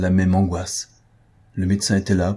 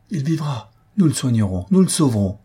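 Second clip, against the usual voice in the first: fervent resolve; 6 semitones higher than usual. Acted. Daughter resolves to save her father.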